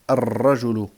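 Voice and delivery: loud "ar-rajulu" with a long rolled r, no L; doubled first letter. Shown as a demonstration. Teacher drilling sun-letter words, doubled r. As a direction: roll and hold the r in "ar-rajulu". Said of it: "on va doubler".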